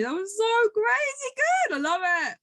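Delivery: high, slow, drawn-out gush of joy; delighted high exclamation. Pretend. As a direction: savour it like the best ride ever. Future self relishing the journey.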